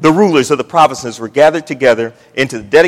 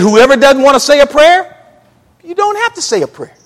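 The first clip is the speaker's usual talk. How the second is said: pitched way up, climbing high then easing off; acted reassurance; 8 semitones higher, 4 dB louder than usual. Preacher voicing the old school prayer rule, in high mock allowance. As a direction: quote it in a lifted, high voice.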